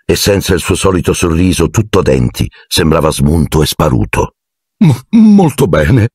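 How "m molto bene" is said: high, slow, stumbling assent; nervous stammer. A narrator's reading. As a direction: stammer the first sound, high and reluctant. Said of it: "Gli tremavano le labbra". (balbettio nervoso e acuto)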